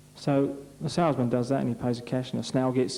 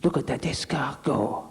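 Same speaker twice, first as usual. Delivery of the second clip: whispered. Acted. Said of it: "And Claude said".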